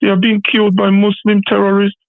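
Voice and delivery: high, drawn-out mock lament; mock crying. Caller mocking a MAGA supporter's online grief, mock crying. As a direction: imitate her wailing with sing-out, put-on sorrow. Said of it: "She was there on Twitter saying".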